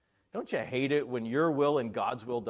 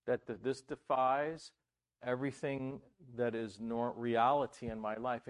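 normal talking voice

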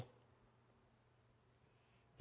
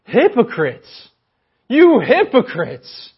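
loud, slow, piercing rebuke; slow thundering accusation; condemning shout; 9 semitones higher, 11 dB louder, slower than usual. Retold or imitated. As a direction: hurl hypocrites at them, slowly. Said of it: "doesn't beat around the bush".

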